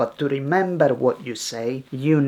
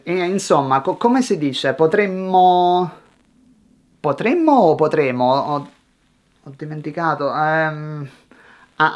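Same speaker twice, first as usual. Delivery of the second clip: halting learner with fillers, restarts and long gaps; thinking aloud while speaking, stumbling; rambling. Shown as a demonstration. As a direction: grope for the words, stretching vowels to stall. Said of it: "That's rambling. Watch this".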